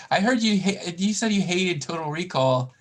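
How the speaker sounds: usual speaking voice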